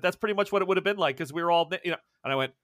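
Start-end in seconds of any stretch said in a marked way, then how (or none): none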